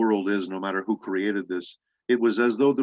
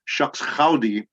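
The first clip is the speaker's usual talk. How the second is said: slow, higher cowboy phrase in a Spanish accent; 4 semitones higher, slower than usual. Shown as a demonstration. Actor reverses Punch's switch for interviewer, in Spanish accent. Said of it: "with a Spanish accent".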